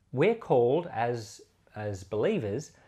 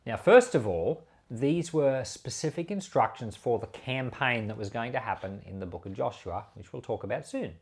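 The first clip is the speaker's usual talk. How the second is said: usual speaking voice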